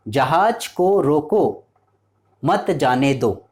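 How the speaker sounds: जहाज को रोको, a clear pause, then मत जाने दो; pause after रोको. Shown as a demonstration. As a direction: break firmly after रोको, slowly delivering both halves. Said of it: "यहाँ पर रोको के बाद कॉमा का यूज़ किया गया है". ("रोको" के बाद साफ़ विराम, धीमे-धीमे)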